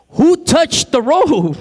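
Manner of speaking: acted-out demand for an answer; demanding question. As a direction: draw out the question as if searching the crowd. Acts Jesus questioning the crowd.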